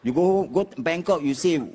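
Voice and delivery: normal talking voice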